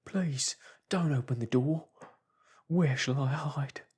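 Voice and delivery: terrified Marvel, begging; frantic pleading. Acted. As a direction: beg for a hiding place.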